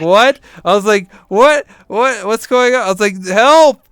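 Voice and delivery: high, loud mock panic; hammed-up frightened yelling; panicked cries. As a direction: cry out in dream terror. Retold panic in dream.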